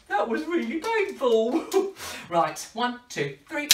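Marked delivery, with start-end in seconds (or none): 0.1-1.6 high-pitched comic complaint; mock pained. Entertainer tells children of snapped nose, mock pained. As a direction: play up the hurt in a high voice. Complains after repeated balloon snaps.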